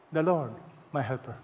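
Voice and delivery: soft, "The Lord", pause, "my helper" dropping low; literal reading without "is". Shown as a demonstration. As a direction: let "my helper" fall quietly after a pause.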